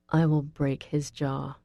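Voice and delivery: low, contained threat; cool anger. A narrator's reading. Reed threatening Russell, cool anger. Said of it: "he says in cool anger".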